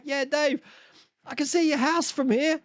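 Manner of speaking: high, breezy reply; mock injured man, unbothered; cheerful punchline. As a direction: say it high and cheerful, as if the view is all that matters. Retold advert, punchline.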